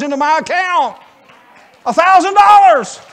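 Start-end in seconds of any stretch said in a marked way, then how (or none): 1.9-3.0 A thousand dollars! shouted high and loud, slow; preacher shouting over an answered prayer; joyful shout. Real, not acted. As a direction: cry out in amazed joy.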